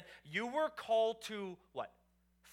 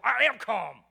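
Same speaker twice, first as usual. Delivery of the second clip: yelled, much louder, denying anger; furious shout; 4 semitones higher, 16 dB louder, slower than usual. Retold or imitated. Angry person yelling back at the one calming them. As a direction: bark it out, clearly not calm. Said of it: "They go".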